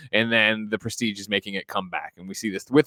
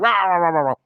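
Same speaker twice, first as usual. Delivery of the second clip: higher, louder crowd chanting; mock crowd uproar; 5 semitones higher, 4 dB louder, faster than usual. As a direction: rile them up like an angry mob. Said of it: "everyone's like".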